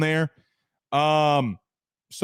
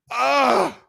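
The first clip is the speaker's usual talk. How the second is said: laugh; 6 semitones higher, 4 dB louder than usual. Real, not acted. Reading mock Stephen A. line.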